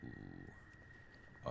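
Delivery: ordinary talk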